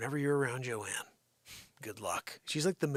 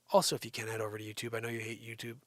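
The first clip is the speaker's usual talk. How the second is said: ordinary talk, nothing marked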